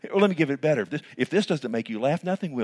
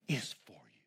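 low and very soft; hushed; 10 semitones lower, 13 dB quieter than usual. Acted. Preacher telling the church God is for them, hushed. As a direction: drop it to a hush.

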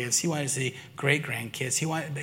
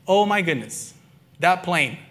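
excited outburst; 4 semitones higher, 5 dB louder than usual. Retold or imitated. Recalling the plane emergency.

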